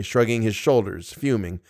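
usual speaking voice

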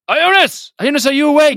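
high, loud in-character shout; urgent yell. Acted. Filner yells to wake companion in a fire, urgent yell. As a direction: call out to rouse a sleeper. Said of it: "Filner will yell".

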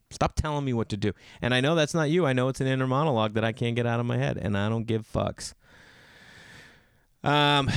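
ordinary delivery

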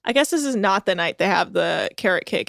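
usual speaking voice

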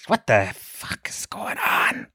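slow, breathless whisper of panic; panicked whisper. Retold or imitated. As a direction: whisper it, totally lost and scared. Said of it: "With a dad, he's like".

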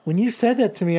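normal talking voice

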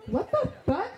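high, half-laughing shock; shocked disbelief. Retold or imitated. Girlfriend reacting to a cheating confession, shocked.

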